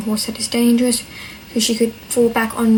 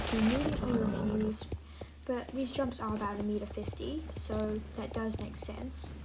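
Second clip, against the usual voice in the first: soft, close voiceover read; voiceover narration; 18 dB quieter than usual. Acted. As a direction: narrate the ride quietly.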